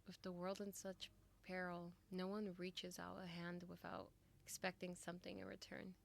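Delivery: ordinary talk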